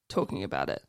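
ordinary talk, nothing marked

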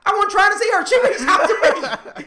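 high, loud, incredulous self-defense; laughing protest. Retold or imitated. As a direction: protest loudly, breaking into laughter. Retold protest in an argument, laughing.

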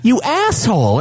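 high-pitched scornful comeback; angry retort. Retold or imitated. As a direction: put him in his place. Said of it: "So I'm like".

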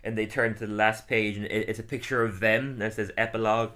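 usual speaking voice

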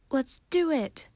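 high, eager cheer; excitedly rallying the group; excited cry. A narrator's reading. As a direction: cry out, raring to go. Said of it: "cried excitedly".

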